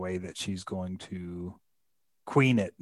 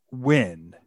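ordinary talk

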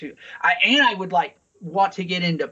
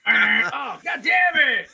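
exasperated outburst; 8 semitones higher, much slower than usual. Real, not acted. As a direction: blow up in jokey frustration.

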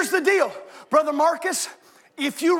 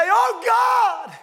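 crying out; 7 semitones higher than usual. Acted. Pastor shows how to pray, crying out. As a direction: call out to God from the chest. Prayer, shout then whisper.

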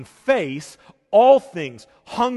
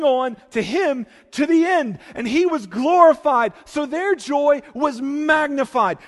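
higher, louder, rising proclamation; full-force preaching; 4 semitones higher, 3 dB louder than usual. Acted. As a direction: build to a triumphant proclamation.

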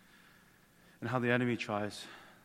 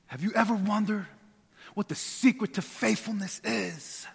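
Instagram guru; 9 semitones higher, 5 dB louder than usual. Pretend. Pastor mocking an Instagram guru pitching the secret to faithfulness. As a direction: play a slick online guru teasing a secret. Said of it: "Turn into an Instagram guru".

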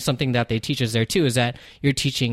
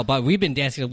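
ordinary delivery

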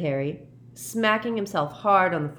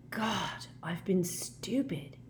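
whispered, softer self-reproach; quiet whisper; 4 semitones higher, 6 dB quieter, much slower than usual. A narrator's reading. Harry whispers to himself staring at the fire. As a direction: breathe it out as the truth dawns. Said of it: "he said quietly".

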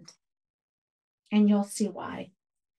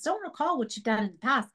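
ordinary delivery

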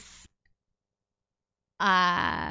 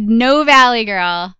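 put-on much louder and slow, leaning on "No Valley Girl"; emphatic quoted note; 4 semitones higher, 13 dB louder, slower than usual. Retelling the ad director's note with loud, slow emphasis. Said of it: "they said".

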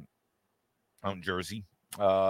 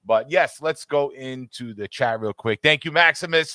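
usual speaking voice

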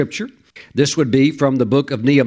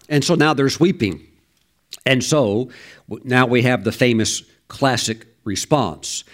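normal talking voice

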